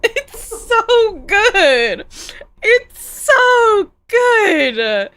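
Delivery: high, loud, drawn-out laughing wail; real cracking up. Real, not acted. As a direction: wail it slowly through helpless laughter. Cracking up at own bit.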